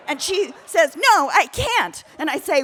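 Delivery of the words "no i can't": loud, high, slow no; the other hiker yelling back; shouted refusal. Retold or imitated. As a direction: refuse her flat-out, yelling. Shouting match, other woman.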